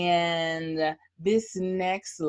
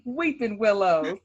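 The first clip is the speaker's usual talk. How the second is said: ordinary delivery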